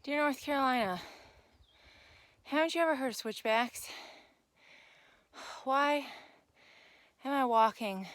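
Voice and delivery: loud frustrated outburst while climbing; exasperated rant. Real, not acted. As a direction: vent at the trail, loud and fed up. Steep climb, exasperated rant at state.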